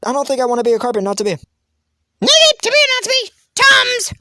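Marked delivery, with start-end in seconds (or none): 1.3-4.1 high-pitched eager contestant voice; high cartoon character. Contestant claiming the role of Tums, high character voice. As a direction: pipe up in a squeaky-high character voice.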